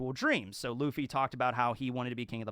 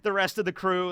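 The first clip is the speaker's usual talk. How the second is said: normal talking voice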